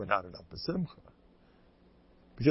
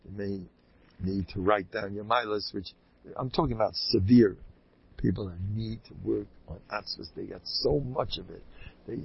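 ordinary talk, nothing marked

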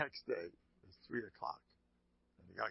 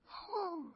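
high, voiced sigh; exasperated sigh. Rabbi settling after the outburst, exasperated high sigh. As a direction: let out a put-on huff after shouting.

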